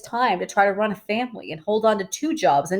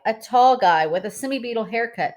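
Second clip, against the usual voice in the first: usual speaking voice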